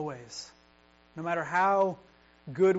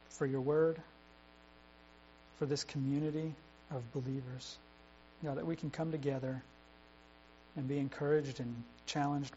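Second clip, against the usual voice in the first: closing prayer; 2 semitones lower, 6 dB quieter than usual. Acted. Pastor prays for the community of believers, soft reverent. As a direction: pray over the congregation gently.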